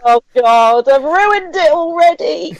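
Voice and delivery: slow, laughing mock despair. Real, not acted. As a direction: laugh it out slowly.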